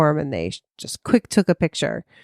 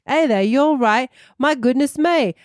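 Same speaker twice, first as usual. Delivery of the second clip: put-on a British accent that slides toward American Southern, pitched up; failed British accent sounding Southern; 6 semitones higher than usual. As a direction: try a posh British accent and drift Southern. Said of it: "I sound Southern".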